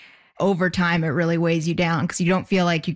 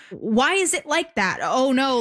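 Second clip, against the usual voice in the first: high-pitched mock shock; verbal gawking; 5 semitones higher than usual. Mimicking strangers gawking at her hands, shocked and high. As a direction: imitate an appalled stranger blurting out a nosy question. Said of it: "a verbal form of gawking where they kinda go".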